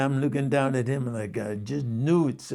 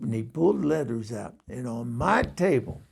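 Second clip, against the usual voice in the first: usual speaking voice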